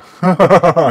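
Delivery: a big burst of laughter, high and loud; real hearty laugh. Real, not acted. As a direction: crack up at your own joke. Laughing at own genie joke.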